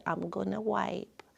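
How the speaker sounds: calm reassurance. Retold or imitated. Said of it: "I was explaining calmly".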